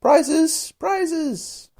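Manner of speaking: very high calls from the crowd; confused calling out. A narrator's reading. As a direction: squeal it like an eager flock. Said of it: "calling out in a confused way".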